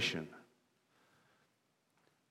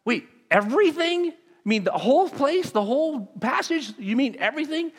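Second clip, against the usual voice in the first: high, disbelieving double-take; surprised; 13 semitones higher, 4 dB louder than usual. Retold or imitated. Pastor hearing the group member's answer, mock astonished. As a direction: play up the baffled surprise. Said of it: "And I'm like".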